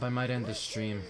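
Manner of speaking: ordinary talk, nothing marked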